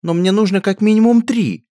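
high, loud outraged cry; shrill protest. A narrator's reading. Princess protesting to the lackey, high loud outrage. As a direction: demand it like a spoiled royal.

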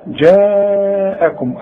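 جاءكم with the alif held four counts, very slow; long madd. Shown as a demonstration. Teacher demonstrating the obligatory four-count stretch. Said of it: "أهو ده المد الواجب".

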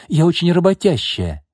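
high, insistent self-praise; exclaiming. A narrator's reading. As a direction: exclaim it defensively. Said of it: "воскликнула Лина".

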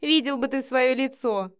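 delighted mockery; amused, near laughter. A narrator's reading. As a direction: tease as if fighting laughter. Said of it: "не сдерживая смех".

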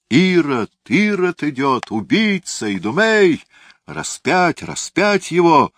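high, loud furious mob cries; crowd yelling. A narrator's reading. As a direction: scream it like a raging crowd. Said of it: "слышались вопли".

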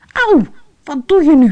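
high, loud cry of pain and shock; pained yelp. A narrator's reading. Rashid when Fatima smashes the egg, pained shock. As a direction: hit out of nowhere.

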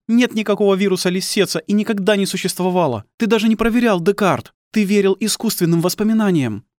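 high-pitched, bitter woman's voice; accusing wife. Acted.

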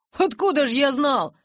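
ordinary delivery, nothing marked

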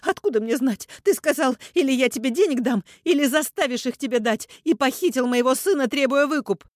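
high, loud unbroken tirade; hysterical accusation. A narrator's reading. Mother feigns innocence to kidnapper, hysterical. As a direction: pile on accusations shrilly.

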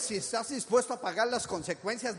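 usual speaking voice

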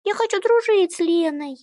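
put-on high whiny child voice; whining little girl. A narrator's reading. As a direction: whine at mom for permission. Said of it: "законючила Иришка".

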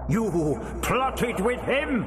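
slow, high accusing voice; thundering. A narrator's reading. Disembodied voice accusing the doctor, thundering. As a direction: accuse slowly in a high, ringing voice. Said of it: "the voice thundered".

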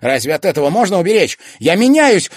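very loud, high outcry; desperate shout. A narrator's reading. Son telling mother he is changing, shouting. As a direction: cry out at the top of the voice. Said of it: "снова закричал Тимофей".

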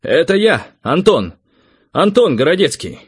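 high, loud hail; calling out. A narrator's reading. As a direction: shout your name up into a big hall. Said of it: "позвал я".

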